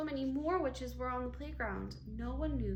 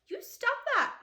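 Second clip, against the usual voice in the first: high, loud angry protest; girl scolding the teasers; 12 semitones higher, 8 dB louder than usual. A narrator's reading. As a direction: stamp your foot and snap it. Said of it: "she said angrily".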